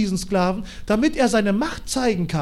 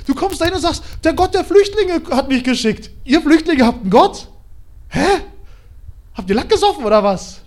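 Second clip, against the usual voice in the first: loud, higher scornful king; mocking pharaoh; 5 semitones higher, 5 dB louder than usual. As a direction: scoff at the slave's god. Pharao verhöhnt Mose weiter. (spöttischer Pharao, laut und höher)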